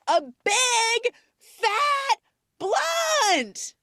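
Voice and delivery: very high, very slow screamed plea; screaming. Retold or imitated. She screams the offer again at him, very high and drawn out. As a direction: wail it out at full lungs. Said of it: "And, like, screams".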